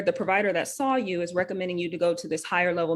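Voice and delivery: ordinary talk, nothing marked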